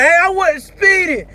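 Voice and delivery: high-pitched mock protest; acting a fool. Driver acting a fool at a traffic stop, indignant mock protest. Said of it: "You don't act a fool".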